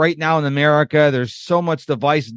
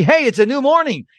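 higher, louder bright hello to the day; cheery greeting; 7 semitones higher, 3 dB louder than usual. Retold or imitated. Showing how to greet a new day, higher and louder. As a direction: greet the morning with mock cheer. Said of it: "It should be".

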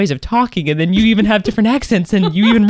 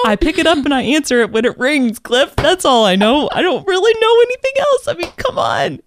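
higher-pitched, playful protest; mock exasperation; 8 semitones higher than usual. Woman retelling how she told Cliff she knows nothing about phones, mock exasperated. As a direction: plead cluelessness in a high, exasperated voice.

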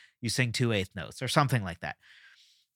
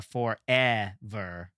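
slow, syllable-by-syllable "forever", middle "eh" emphasized; stress on middle of forever; much slower than usual. Shown as a demonstration. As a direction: break "forever" into for-eh-ver, leaning on "eh". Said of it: "the eh is clearly emphasized".

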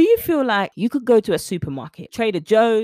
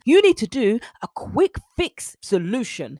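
ordinary talk, nothing marked

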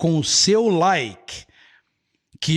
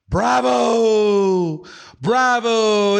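cheering bravo; 5 semitones higher, 4 dB louder, much slower than usual. Acted. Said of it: "You were shouting bravo".

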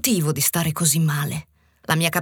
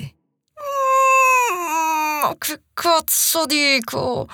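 crying out; 10 semitones higher, much slower than usual. Real, not acted. Embarrassed outburst.

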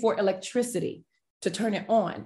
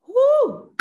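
a sudden high whoop, much higher; excited whoop; 14 semitones higher, 5 dB louder than usual. Real, not acted. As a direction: let out a high, loud whoop of feeling. Overwhelmed by the memory.

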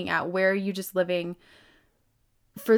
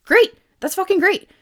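a high, loud burst of delight; excited cheer; 11 semitones higher, 8 dB louder than usual. Real, not acted. Speaker cheering a viewer who has realized their life isn't theirs, high and loud.

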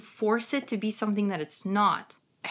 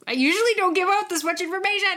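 excited; 10 semitones higher, 5 dB louder, much faster than usual. Real, not acted. Playful teasing aside.

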